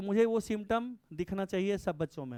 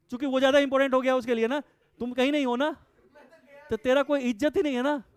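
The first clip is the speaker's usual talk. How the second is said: loud, fast scolding lecture; 6 semitones higher, 7 dB louder than usual. Real, not acted. Teacher scolds students for wasting time, loud and fast. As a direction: tear into them, louder and higher.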